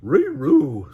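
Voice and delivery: loud barky dog-speak; loud Scooby-Doo yelp; Scooby bark. Acted. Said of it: "Scooby barked".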